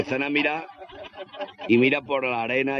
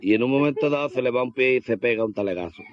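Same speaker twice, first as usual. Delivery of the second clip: ordinary talk, nothing marked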